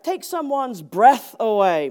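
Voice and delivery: ordinary talk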